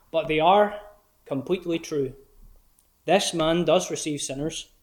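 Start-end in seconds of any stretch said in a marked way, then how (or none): none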